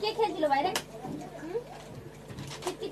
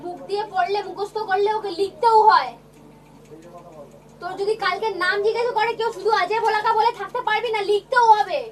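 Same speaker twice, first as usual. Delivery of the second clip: loud, raised teacher scold; angry scolding; 3 semitones higher, 8 dB louder than usual. Real, not acted. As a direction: shout at a lazy pupil. Teacher scolding a lazy student. (রাগী গলায় জোরে বকুনি)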